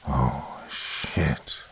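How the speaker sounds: low breathy creature whisper; deep throaty whisper. A narrator's reading. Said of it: "whispered in a deep, throaty voice".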